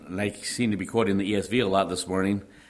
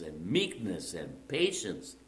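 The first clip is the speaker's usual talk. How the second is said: "meekness" and "patience" dropped low and much softer, slowly falling; softly falling list; 3 semitones lower, 9 dB quieter, slower than usual. Acted. Preacher repeating the virtues to the congregation, soft and falling. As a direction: let each word settle gently.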